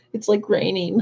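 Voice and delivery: very high squeaky mock of the driver; mocking ditzy girl. Mocking the driver who hit his car, squeaky high voice.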